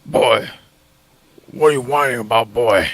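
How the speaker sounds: low, gruff father growl; deep dismissive dad voice; Kratos voice. Retold or imitated. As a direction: rumble it down like a stern god. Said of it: "Kratos is purring".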